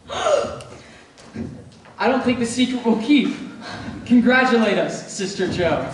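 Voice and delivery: bursting with happy news; excited. Acted. Announces the engagement in joy.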